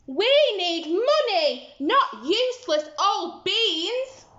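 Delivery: mother scolding Jack for worthless beans; angry mother. Acted. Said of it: "she cried".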